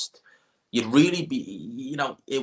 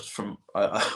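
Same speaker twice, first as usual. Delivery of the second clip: normal talking voice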